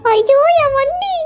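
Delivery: slow, high drawn-out wail; hammed-up grief, stretched and high; wailing lament. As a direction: wail slowly over a lost prize. Mock grief over lost bike.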